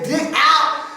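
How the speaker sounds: usual speaking voice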